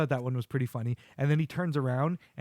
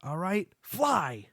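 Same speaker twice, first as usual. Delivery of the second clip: slow command; 5 semitones higher, much slower than usual. Retold or imitated. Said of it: "And he goes".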